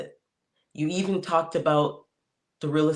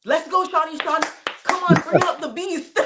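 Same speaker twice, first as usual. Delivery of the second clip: much higher mock cheer with laughter; hammed-up hype-dad yell, laughing; imitated yelling; 13 semitones higher, 3 dB louder than usual. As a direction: shout it across the gym like a proud dad. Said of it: "he's literally yelling out".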